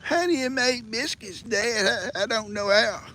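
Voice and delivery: high, whiny mock voice; whining son. Biscuit King mocking son Dan asking for recipe, high whine. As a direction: imitate a whiny grown son. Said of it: "he's whining".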